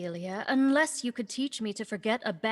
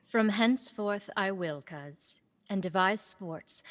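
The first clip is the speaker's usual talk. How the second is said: usual speaking voice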